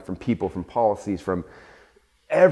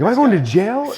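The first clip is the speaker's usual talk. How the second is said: high, loud frightened question; a mock-terrified gasp of a question; panicked; 12 semitones higher, 6 dB louder than usual. Founder recalling the certified letter from the FBI, high frightened question. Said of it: "I'm getting a letter, like, certified from the F B I".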